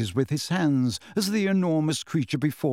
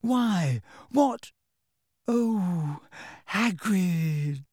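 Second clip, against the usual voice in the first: high bewilderment sinking into dismay; close to crying; 4 semitones higher, much slower than usual. A narrator's reading. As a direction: start high, then sigh the name in dismay. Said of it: "sounded as though she wanted to cry".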